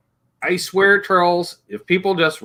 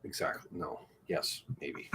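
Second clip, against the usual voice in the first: whispered aside; 10 semitones lower, 21 dB quieter than usual. Real, not acted. Muttered aside.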